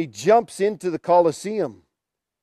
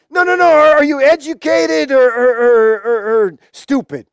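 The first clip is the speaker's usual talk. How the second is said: high, louder start easing into stammered questioning; lightly acted ruler losing patience; exasperated emperor; 6 semitones higher, 6 dB louder than usual. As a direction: cut in, then fumble for the question.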